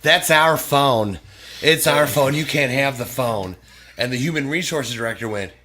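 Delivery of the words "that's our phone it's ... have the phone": loud, slow, insistent mock of a controller; bossy demand. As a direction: plant your feet and demand the phone back. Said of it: "super bitch controller went".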